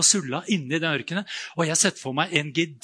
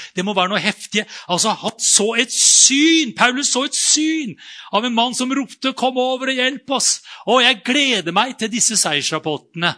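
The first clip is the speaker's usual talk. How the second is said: louder, higher, rousing sermon delivery; fiery preaching; 3 semitones higher, 5 dB louder than usual. Acted. Preacher rousing the congregation about Paul's vision, loud. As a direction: preach it out with rising fervor. Preaching builds to full force.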